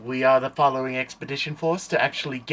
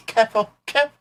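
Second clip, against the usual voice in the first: high, loud alarmed character voice; NPC alarm; 10 semitones higher, 8 dB louder, much faster than usual. Acted. Creature flinching from the fire, high alarmed cry. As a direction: yelp a panicked warning.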